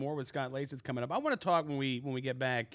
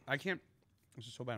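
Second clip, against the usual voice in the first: talking through a mouthful; words around a bite of food; mouth full; 6 dB quieter, faster than usual. Real, not acted. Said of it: "I'm talking with my mouth full".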